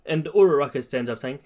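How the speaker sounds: usual speaking voice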